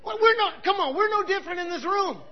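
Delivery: normal talking voice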